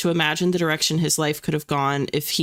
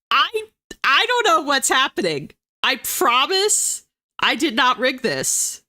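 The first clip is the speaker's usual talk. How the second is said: amazed disbelief; 6 semitones higher than usual. Real, not acted. Bizarre wheel spin result.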